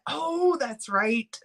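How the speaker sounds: ordinary talk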